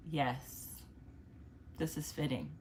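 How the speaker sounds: quietly, as if pleased with yourself; soft quoted remark. Retold or imitated. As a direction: murmur it softly to yourself. Imitating own past self.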